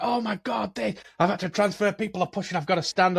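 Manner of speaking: a hint of panicked traveller; mock frantic friend. Retelling his mate's train chaos, mock frantic.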